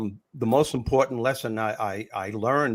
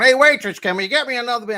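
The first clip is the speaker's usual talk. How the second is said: imitating a loud drunk heckler, much higher and louder; drunk heckler yelling; 12 semitones higher, 7 dB louder than usual. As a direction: bellow across the club for the waitress. Drunk heckler calling the waitress.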